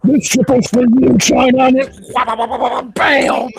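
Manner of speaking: very loud mock boast into a microphone; cocky skater commentary. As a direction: shout it like self-hyping mid-routine. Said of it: "talking during it".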